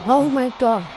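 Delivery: loud, high oh my God; genuinely spooked exclamation; shocked. Real, not acted. Reacting to spooky playback.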